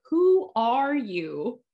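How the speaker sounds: slow, drawn-out "Who are you?" through a laugh; mock Chuck. Recapping Chuck's line to cohost, slow laughing mockery. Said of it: "Chuck is like".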